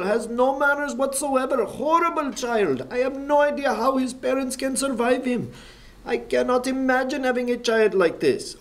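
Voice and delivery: put-on high, Pakistani-accented outrage; indignant Pakistani accent voiced high. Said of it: "I'm trying not to laugh".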